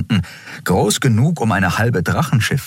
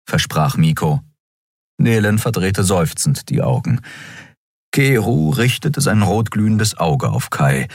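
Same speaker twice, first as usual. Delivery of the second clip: ordinary delivery, nothing marked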